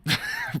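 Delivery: sudden squeaky laugh; real giggle fit; high burst of laughter. Real, not acted. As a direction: laugh out high at a blank mind. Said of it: "my brain just stopped".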